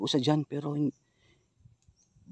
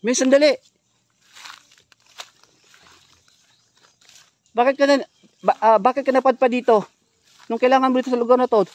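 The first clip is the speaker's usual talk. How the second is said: loud, higher call to a stranger; 7 semitones higher, 10 dB louder than usual. Real, not acted. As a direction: shout after her so she waits. Calling to the girl again.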